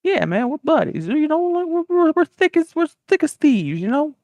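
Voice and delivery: high-pitched comic assurance; hammed-up chummy patter; over-friendly insistence. America insists they are buddies.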